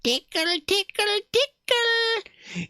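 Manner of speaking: tickle voice. Acted. Streamer voicing a playful tickle, high and rising.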